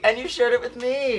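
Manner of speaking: high, loud, delighted; gushing. Real, not acted. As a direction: gush with delight. Gushing thanks to the host.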